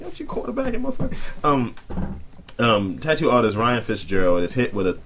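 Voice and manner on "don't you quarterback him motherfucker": very high put-on voice; mock outburst. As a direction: blurt it in a silly high pitch. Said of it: "It's like".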